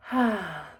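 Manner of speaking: long breathy exhale; audible sigh. Real, not acted. Meditation teacher settling in before the lesson, audible sigh.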